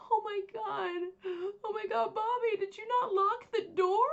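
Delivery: high, loud, tearful panic; hysterical crying. Retold or imitated. Mother finds her husband dead and blames her daughter, hysterical crying. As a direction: sob it out in a frantic, high-pitched rush. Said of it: "Jackie is in hysterics".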